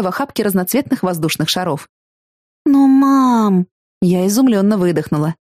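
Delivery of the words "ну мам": loud, high whine at mom; surprised protest. A narrator's reading. (удивлённый протест)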